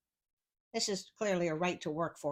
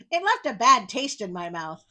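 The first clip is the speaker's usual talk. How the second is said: ordinary delivery, nothing marked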